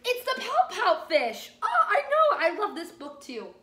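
loud, high, laughing reveal; excited reveal. Real, not acted. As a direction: burst out with the title. Big reveal of the book.

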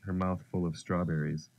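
ordinary delivery, nothing marked

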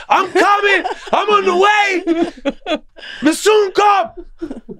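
very high, slow laughing reassurance; Joe on the phone. Retold or imitated. Friend stalling on the phone, high, slow and laughing. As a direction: stretch it out high and amused, not bothered.

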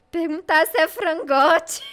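loud, high, amused disbelief; incredulous. Real, not acted. Streamer reads out an absurd option to a chick, incredulous. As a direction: blurt it out loudly.

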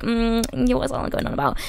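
soft, dismissive aside. Acted. Vio shrugging off what Arnold said, dismissive. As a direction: brush it off under the breath. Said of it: "she's kinda just like".

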